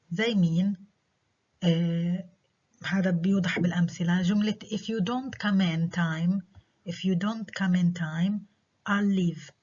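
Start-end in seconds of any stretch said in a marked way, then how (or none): 4.6-9.5 "time" rises, then "I'll leave" falls; non-terminal rise. Shown as a demonstration. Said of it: "Non-terminal tone group".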